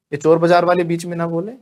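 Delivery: ordinary talk, nothing marked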